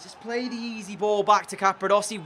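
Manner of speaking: "back" stressed in frustration; exasperated, telling him what he should have done; exasperated plea. Real, not acted. Exasperated at teammate's mistake.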